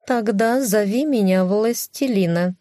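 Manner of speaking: high grand cat voice; proud cat voice. Acted. (гордый кошачий голос)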